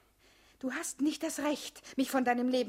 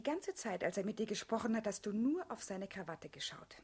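teasing whisper; 3 semitones lower, 7 dB quieter than usual. Acted. Teasing whisper about his tie.

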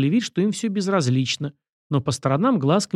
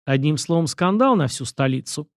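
plain reading voice

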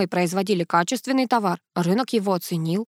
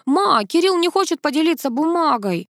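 put-on high, mildly raised voice, a child tattling; higher-pitched indignant kid yelling; boy's shout; 8 semitones higher, 4 dB louder than usual. A narrator's reading. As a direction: call out a complaint to mum. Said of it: "и закричал".

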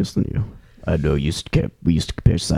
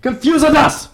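high, put-on broken English accent; hammy high comic sage voice; mock Chinese accent. Comic Confucius persona.

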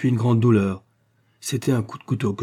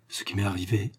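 lowered voice; 7 dB quieter than usual. A narrator's reading. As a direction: say it hushed, as if someone might overhear. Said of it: "en baissant la voix".

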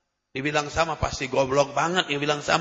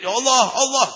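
mock crying out; 7 semitones higher, 4 dB louder than usual. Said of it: "jerit-jerit".

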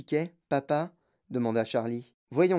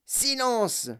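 shouting; 7 semitones higher than usual. Acted. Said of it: "hurlait-il".

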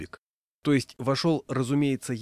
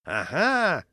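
high, pouncing cry; triumphant cry; 8 semitones higher, 4 dB louder than usual. A narrator's reading. Raincoat man accusing the dog owner, crying out. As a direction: cry out as if catching someone. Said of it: "закричал человек в рыжем плаще".

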